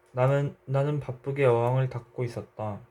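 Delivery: plain reading voice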